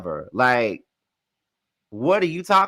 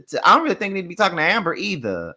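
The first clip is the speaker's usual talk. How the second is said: normal talking voice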